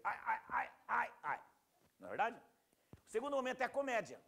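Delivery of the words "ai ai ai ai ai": loud, high "ow, ow" cries; hammed-up yelps of pain; mock suffering wail. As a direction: wail each cry as if suffering, playfully overdone. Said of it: "já voltei a sofrer".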